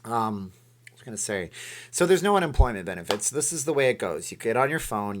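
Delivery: ordinary talk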